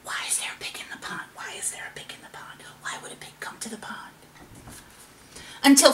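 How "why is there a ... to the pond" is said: hushed, rapid whisper; whispering frogs. A narrator's reading. Frogs gossiping amongst themselves about the pig, whispered. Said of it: "They whispered amongst themselves".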